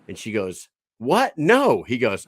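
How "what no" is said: high, loud startled no; shocked woman. Acted. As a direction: she recoils in disgust. Joke voicing the shocked woman.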